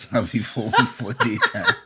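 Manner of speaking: laughing through the words; giggling while speaking. Real, not acted. Laughing at his own mix-up.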